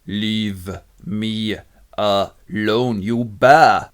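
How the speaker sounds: slow, word-by-word rebuff; exasperated. Acted. Demetrius shaking off Helena, exasperated. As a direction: space out each word, punching the last. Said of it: "And he goes".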